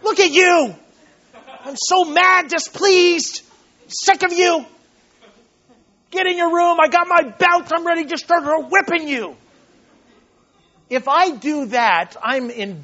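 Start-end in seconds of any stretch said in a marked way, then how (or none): 0.0-9.4 loud, high hammed-up shouting at a child; mock furious parent. Preacher acting out an angry father with a belt, mock shouting. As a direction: play the raging father sending the kid to his room. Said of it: "I don't do it in anger".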